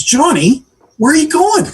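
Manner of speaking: high-pitched mock boss questioning. Boss asking Johnny as he packs up, high mock questioning.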